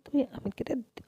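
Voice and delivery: usual speaking voice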